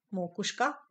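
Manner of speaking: normal talking voice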